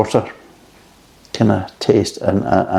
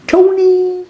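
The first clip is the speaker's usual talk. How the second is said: calling out. Retold or imitated. Calling out coworker's name.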